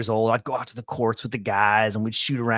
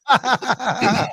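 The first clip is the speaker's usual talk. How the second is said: high, stunned what; a pitched-up squeak of disbelief; shocked what; 12 semitones higher than usual. Retold or imitated. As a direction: blurt it out in disbelief. Shocked at friend's question.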